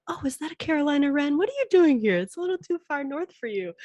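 high, quick, thrilled chatter at a bird; excited birdwatcher. Retold or imitated. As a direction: gush at the wren like spotting a rare treasure. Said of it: "I'm excited".

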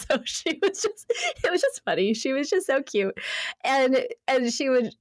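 ordinary delivery

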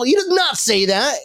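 ordinary talk, nothing marked

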